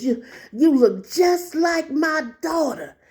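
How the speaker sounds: put-on slow, drawn-out old lady voice; imitated elderly woman. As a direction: stretch it out like an emotional elderly lady. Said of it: "this is how she talked".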